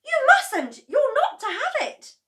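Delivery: very high, very loud panicked cry; cried out. A narrator's reading. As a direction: pull the cup away in alarm. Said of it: "George cried out".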